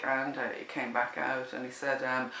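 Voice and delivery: normal talking voice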